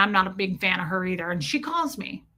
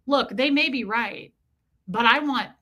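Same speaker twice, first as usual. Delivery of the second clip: usual speaking voice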